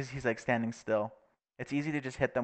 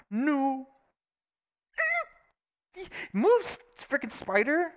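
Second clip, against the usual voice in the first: startled; 14 semitones higher, slower than usual. Real, not acted. Startled by a spider.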